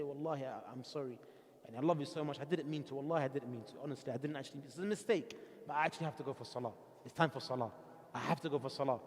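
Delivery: low, soft, sheepish husband voice pleading sorry; hushed and apologetic, easing out of trouble; soft apology to wife. Pretend. Husband soothing his screaming wife before slipping off, low and soft. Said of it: "listen to her and say".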